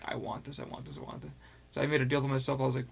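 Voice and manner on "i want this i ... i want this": whispered, greedy craving; hushed wanting whisper; longing whisper. Retold or imitated. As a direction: breathe it like you're coveting a toy. Craving the Move controllers.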